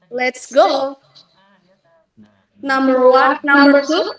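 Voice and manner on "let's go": LET'S GO with a lift on "go"; demonstrated pitch lift on "go"; rising intonation. Shown as a demonstration. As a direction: push "go" upward as a tune demo. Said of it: "rising intonation".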